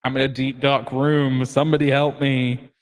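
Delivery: echoing cry from the dark; calling out through reverb. Acted. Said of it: "You love that reverb".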